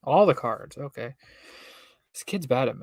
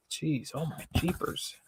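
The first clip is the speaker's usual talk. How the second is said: ordinary delivery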